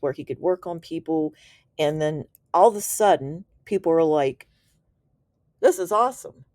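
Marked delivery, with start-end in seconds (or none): 5.6-6.4 higher, eager mock exclamation; light imitation of excited students. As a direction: voice the crowd's enthusiasm. Retold eager people quoted.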